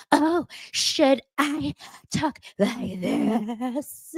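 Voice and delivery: slow, high, put-on mocking voice; mocking fake voice. Sutton mocking Dorit's complaint in a slow, put-on voice. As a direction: drag it out like a sneering imitation. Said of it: "anyone mocks and does that".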